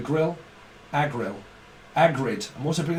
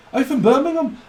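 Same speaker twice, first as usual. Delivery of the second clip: much higher falling Brummie repeat; Brummie accent; 10 semitones higher, 5 dB louder than usual. Retold or imitated. Brummie delighted to meet another, high mock Brummie accent. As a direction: squeal it again in a hammed-up Birmingham accent. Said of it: "That's how they speak up in Brummie land".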